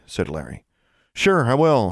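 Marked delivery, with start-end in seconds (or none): 1.1-1.9 higher-pitched sarcastic agreement; dry fake agreement, pitched up. A narrator's reading. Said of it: "she said sarcastically".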